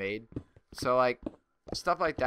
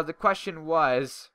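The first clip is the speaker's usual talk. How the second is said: ordinary talk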